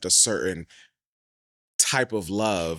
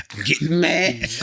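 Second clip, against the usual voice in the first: laughing; 8 semitones higher, 7 dB louder, slower than usual. Real, not acted. Laughing mock anger.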